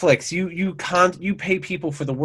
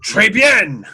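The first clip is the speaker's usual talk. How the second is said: loud, higher chef exclamation; French chef; 6 semitones higher, 8 dB louder than usual. Retold or imitated. Co-host imitates the chef cutting off heads, loud exclamation. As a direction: call it out as a flourishing French chef. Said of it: "that chef character".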